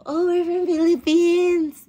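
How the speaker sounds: high, slow, coy answer; coy reply. Retold or imitated. Telling the foreigner where she is from, high and coy. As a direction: answer sweetly, playing shy. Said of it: "Sabi ko".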